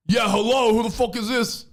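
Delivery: high-pitched, irritated hello; brusque phone answer. Acted. Radio host answers a stranger's call, brusquely irritated. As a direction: pick up like you resent the call.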